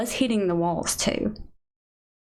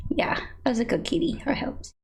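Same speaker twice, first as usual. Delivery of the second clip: quick breathy whisper for the kitty; voicing the cat; 6 semitones higher, faster than usual. Owner speaks for her cat Kiki, in a quick whispered pet voice. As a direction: answer for the cat in a tiny hushed voice.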